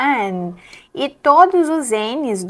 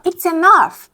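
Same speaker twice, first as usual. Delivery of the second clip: higher, louder It's enough as if slamming the table; fed-up outburst; 7 semitones higher, 6 dB louder, slower than usual. Shown as a demonstration. Teacher slams the table, demoing an angry enough. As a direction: snap it out like you've had it. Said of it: "dá um tapão na mesa e fala".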